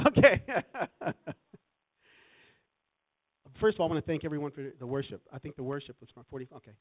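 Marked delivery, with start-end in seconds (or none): 0.0-1.2 loud burst of real laughter around the word; laughing. Real, not acted. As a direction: laugh out a high, amused okay. Laughs at the time left.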